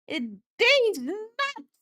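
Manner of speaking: high, slow drawn-out punchline; high slow mock-dramatic punchline; joke voice. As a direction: stretch it out high for the gag.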